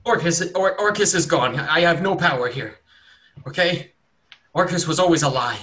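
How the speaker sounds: desperate to convince him; panicked cultist. Acted. Cultist wriggling free, frantically denying Orcus.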